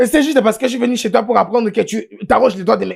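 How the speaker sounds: loud, high, stammering outrage; furious quarrelling. Acted. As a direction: burst into an indignant tirade. Heated quarrel with teacher. (tirade furieuse, forte, aiguë et bégayante)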